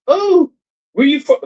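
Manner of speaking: high, eager mock greeting; lightly voiced curious Filipina; mimicking local woman. Man imitates women greeting him on arrival, high mock voice. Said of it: "soon as they see".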